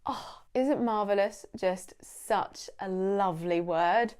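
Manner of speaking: usual speaking voice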